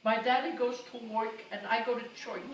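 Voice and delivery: put-on toddler voice. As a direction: say it like a small child. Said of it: "she spoke Carmelese".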